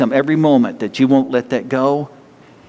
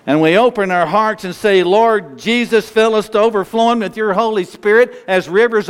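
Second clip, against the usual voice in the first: high-pitched, heartfelt congregational prayer; preacher's impassioned closing prayer; fervent prayer; 6 semitones higher than usual. Acted. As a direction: lead the church in earnest prayer, pitch lifted.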